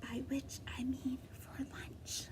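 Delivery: sly unvoiced whisper; menacing whispered aside. A narrator's reading. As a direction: breathe it as a secret aside. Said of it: "he whispered".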